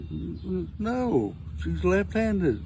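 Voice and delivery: high stuttered no, slow punchline; husband caught out by his wife's question; stammering husband. Retold or imitated. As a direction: stumble on the no, then drag out the reveal.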